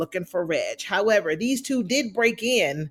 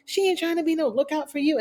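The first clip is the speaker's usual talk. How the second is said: pitched up high, talking back at the screen; sassy retort; 8 semitones higher than usual. Retold or imitated. Reacting to the episode, she answers Reg in a high, sassy retort. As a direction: scoff at the idea with mock outrage. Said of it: "And I'm like".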